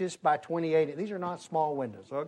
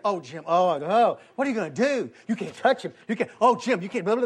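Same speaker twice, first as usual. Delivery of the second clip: higher, louder fussing plea; mock fretting wife; 4 semitones higher, 7 dB louder than usual. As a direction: fret over him like an anxious wife. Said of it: "If you're gonna talk to Peter".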